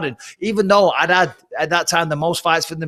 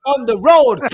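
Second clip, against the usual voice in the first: higher, louder, whiny desperate urging; desperate plea; 7 semitones higher, 5 dB louder than usual. Retold or imitated. Bursting for the loo, begging the fighter to hurry, whiny plea. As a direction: beg him to hurry up, bursting. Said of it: "I'm like".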